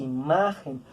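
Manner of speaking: "imagen" low and falling, weight on MA; stress on MA. Shown as a demonstration. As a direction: lean on the middle syllable of "imagen". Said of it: "Imagen tiene el acento aquí".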